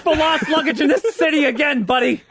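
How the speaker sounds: high, hammed-up outraged threat; mock tough-guy warning. Co-host mimics the angry threat.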